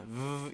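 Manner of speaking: "v" as a held, voiced buzz; voiced V sound. Shown as a demonstration. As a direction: add voice to a lip-on-teeth "v" hum. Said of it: "your voice".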